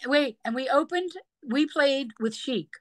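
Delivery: ordinary talk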